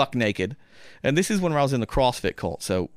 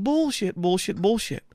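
high mocking disbelief; 7 semitones higher than usual. Inner disbelief again.

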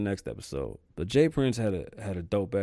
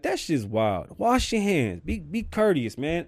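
ordinary delivery

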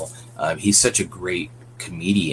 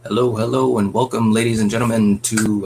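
showman welcome, a bit louder; grand entrance announcement; 3 semitones higher, 3 dB louder than usual. Acted. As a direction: greet them like a ringmaster. Podcast host opens the show.

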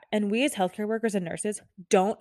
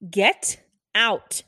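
exasperated order; 6 dB louder than usual. Acted. Acted order to intruding family.

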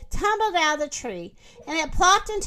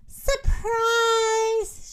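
high, drawn-out call; singing out the surprise; excited reveal; 8 semitones higher than usual. Acted. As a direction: announce it like a big surprise.

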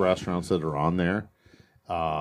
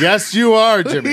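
high, loud cheer through laughter; mock cheering; 13 semitones higher, 9 dB louder, slower than usual. Friend playfully cheers on the cab driver, high excited shout. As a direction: egg him on, high and loud.

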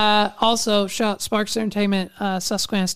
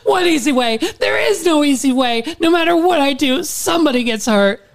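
louder and higher, torn; anguished outburst; 6 semitones higher, 4 dB louder than usual. Acted. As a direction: cry out in despair. Acted quarrel, she cries out.

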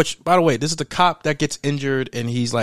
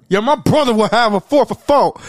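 loud, high mock customer holler; rowdy hollering; 9 semitones higher, 7 dB louder than usual. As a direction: yell the order out, high and rowdy. Acted customer ordering at drive-through.

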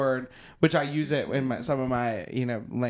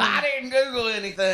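high, laughing mimicry of a friend; mocking echo; 9 semitones higher, 4 dB louder than usual. Teasing a friend accused of Googling, high mocking echo. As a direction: parrot the denial in a high voice.

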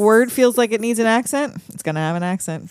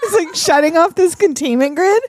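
mock worker voice; 5 semitones higher than usual.

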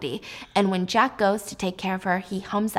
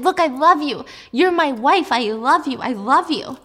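loud, higher, pleading declarations; desperate insistence; 5 semitones higher, 7 dB louder than usual. Acted. Jack insisting to Alice he loves her, loud and desperate. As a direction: force the love on her.

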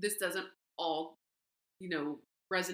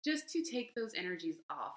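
ordinary talk

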